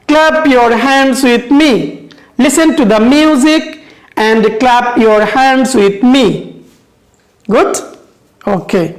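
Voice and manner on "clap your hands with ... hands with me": slow, measured rhyme for pupils to repeat; drawn-out teaching chant; rhyme recitation. Shown as a demonstration. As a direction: recite it slowly, line by line. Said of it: "four lines together".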